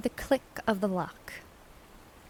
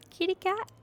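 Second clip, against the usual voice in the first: "Kitty cat" sung out very high; high-pitched coaxing call to a cat; 10 semitones higher than usual. Shown as a demonstration.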